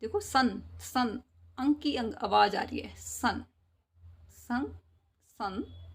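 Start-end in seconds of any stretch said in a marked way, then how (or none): none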